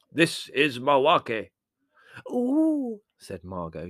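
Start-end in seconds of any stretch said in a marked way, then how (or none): 0.1-1.5 very slow, loud, raised trance voice; clear announcement. A narrator's reading. Medium introducing her spirit guide, clear and slow. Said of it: "and then said clearly". 2.3-3.0 high, loud squeal of delight. A narrator's reading. Margot as the spirit arrives, delighted.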